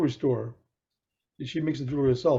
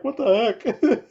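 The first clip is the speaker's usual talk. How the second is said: higher, drawn-out mock disbelief; amused bewilderment; 7 semitones higher, 4 dB louder, much slower than usual. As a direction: retell a baffled reaction, stretched and high. Teller reacts to her crying, laughing.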